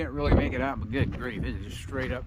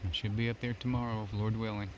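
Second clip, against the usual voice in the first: ordinary delivery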